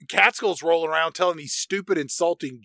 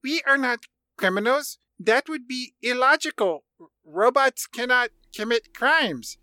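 higher, stiff robot logic voice; 8 semitones higher than usual. Retold or imitated. Host voicing robot Val denying crime, higher stiff robot voice. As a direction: play a prim literal robot. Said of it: "And then Val says".

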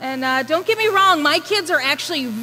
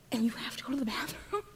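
exasperated whisper; 11 dB quieter, faster than usual. Retold or imitated. Mom's exasperation turns to whisper.

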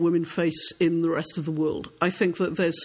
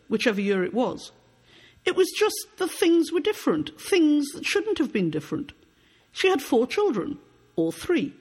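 higher-pitched literary reading with falling phrase ends; story narration; 4 semitones higher than usual. Acted. Author reading her novel to an audience, story narration. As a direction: read it aloud as measured novel prose, pitch lifting then falling.

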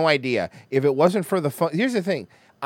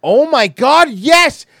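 higher and louder, a sudden burst of real delight; excited outburst; 10 semitones higher, 11 dB louder, much slower than usual. Real, not acted. Streamer reacting to a viewer's logo idea, excited shout. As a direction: blurt it out, thrilled by the idea.

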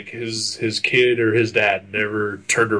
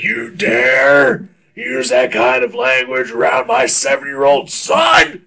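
indignant dad; 4 semitones higher, 6 dB louder, slower than usual. Retold or imitated. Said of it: "he was like".

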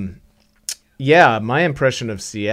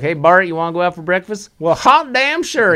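Missouri twang; 8 semitones higher, 5 dB louder than usual. Shown as a demonstration. Singer shows how he talks on visiting family in Missouri, with a Missouri twang. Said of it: "I just start talking like this".

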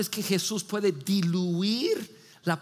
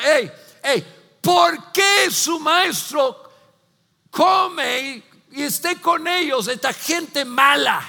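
loud, higher, slow taunting call; accusing shout; 5 semitones higher, 6 dB louder, slower than usual. Retold or imitated. Pharisees loudly shaming Jesus before the guests.

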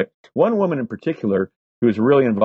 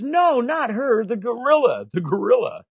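exasperated cop; 13 semitones higher than usual. Retold or imitated. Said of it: "his partner looks at him and he goes".

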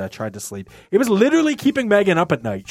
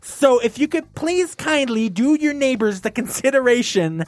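high, overly polite mock formal tone; prim polite note voice; 7 semitones higher than usual. Reading his own polite note aloud, high and prim. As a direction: read the note like a prissy complaint. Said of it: "left a note on their door that said".

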